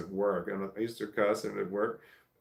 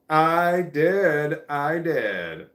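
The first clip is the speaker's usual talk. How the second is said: loud, raised-pitch insistence; emphatic insisting; 6 semitones higher, 9 dB louder, much slower than usual. Real, not acted. Streamer answering a chatter's doubt, loud and emphatic. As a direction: insist it at full volume, drawn out.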